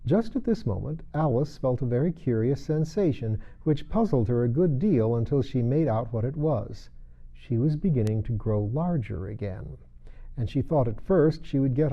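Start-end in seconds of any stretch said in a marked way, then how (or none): none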